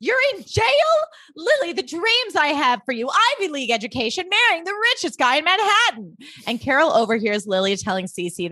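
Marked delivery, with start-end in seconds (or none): 0.0-6.2 high, shrill-free indignant mother voice, much higher; outraged mother. Retold or imitated. Mother hearing her daughter is in jail, high outraged voice. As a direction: play the horrified society mother. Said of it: "and she's like".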